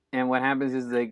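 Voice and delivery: ordinary talk, nothing marked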